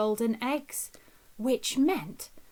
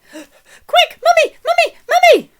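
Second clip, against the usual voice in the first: put-on much higher, loud urgent child's cry; frantic calling; 14 dB louder than usual. A narrator's reading. Jack at the beanstalk bottom calling Mummy, frantic. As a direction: shout for Mummy in a panic.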